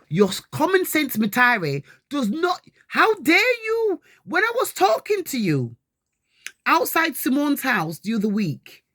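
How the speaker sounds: angry. Real, not acted. Mother scolds son, outraged.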